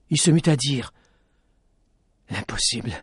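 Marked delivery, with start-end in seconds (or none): 2.3-2.9 delirious murmur. Acted. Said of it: "Dans son délire".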